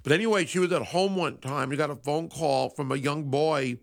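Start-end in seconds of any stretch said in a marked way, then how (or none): none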